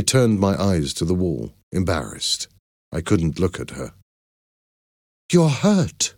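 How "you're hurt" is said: high startled concern; exclaimed. A narrator's reading. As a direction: gasp it out in alarm, pitched high. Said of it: "she exclaimed".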